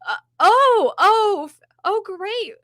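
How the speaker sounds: high, loud, strained fake delight; wife faking joy at the house her husband bought; forced enthusiasm. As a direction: pretend to be thrilled while hating it. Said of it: "You can just tell on her face".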